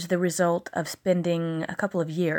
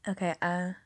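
ordinary talk, nothing marked

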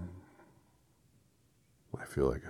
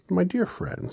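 pleading wizard; 6 dB louder than usual. Acted.

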